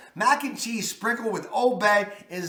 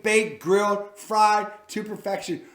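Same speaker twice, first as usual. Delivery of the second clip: ordinary talk, nothing marked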